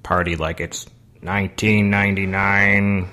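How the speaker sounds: monotone party robot, held on level notes. Pretend. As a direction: talk like a party-loving robot, syllables evenly sustained. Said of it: "what's up with the robot voice".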